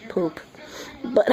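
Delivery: normal talking voice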